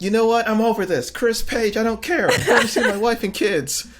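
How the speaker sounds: high, loud, exasperated outburst; mock fed-up rant. Producer quitting on the couple, high loud mock exasperation. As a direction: throw up your hands and quit, loudly.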